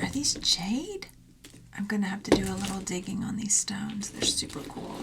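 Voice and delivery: soft curious whisper. Real, not acted. Whispering while examining jade stones. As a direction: murmur while peering at the stones. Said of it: "peering closely at stones".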